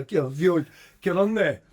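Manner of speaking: ordinary talk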